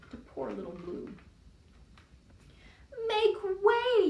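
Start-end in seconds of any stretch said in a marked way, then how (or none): 3.1-4.1 high loud demand; yelled. Acted. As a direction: order everyone aside. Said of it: "yelled a limousine".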